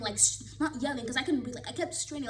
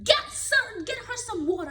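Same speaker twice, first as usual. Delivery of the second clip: mock yell; 10 semitones higher, 7 dB louder than usual. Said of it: "They were yelling".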